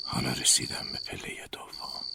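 hushed eerie whisper on each word; ghostly whisper. Acted. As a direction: breathe it like an unseen ghost.

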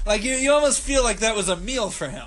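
ordinary delivery

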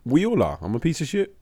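normal talking voice

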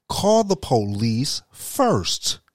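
very slow, each word spelled out; slow and deliberate. Shown as a demonstration. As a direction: lay out the order slowly. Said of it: "Listen to that very closely".

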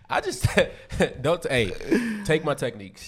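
high laughing speech. Real, not acted. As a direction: laugh through the words. Laughing through the words.

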